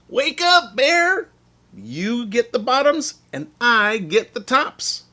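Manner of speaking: high, loud wake-up call. Acted. Hare calling out to sleeping Bear, high and loud. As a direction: Hare shouting across the field to wake Bear. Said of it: "Hare called out".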